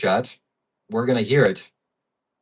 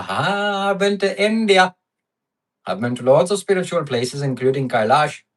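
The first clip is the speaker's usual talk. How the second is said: put-on Indian accent; 3 semitones higher than usual. Streamer jokes to chat about having been to India, mock Indian accent. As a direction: laugh into a hammed-up Indian accent. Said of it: "don't cancel me for that accent".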